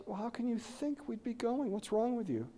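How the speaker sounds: high, soft mock-tearful voice; crying mom. Mom answering her thoughtless son, mildly imitated crying. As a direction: imitate a hurt mother protesting through tears. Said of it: "She's crying and says".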